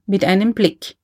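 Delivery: ordinary delivery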